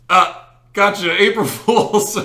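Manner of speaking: high, loud, slow, laughing gotcha; spring the punchline with a laugh; the prankster reveals his joke to the stunned teacher; gleeful reveal. Retold or imitated.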